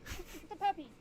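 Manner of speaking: high, bright call to a puppy; excited calling out. Acted. Calls out to the warg pup.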